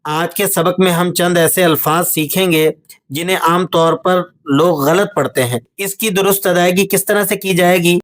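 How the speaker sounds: normal talking voice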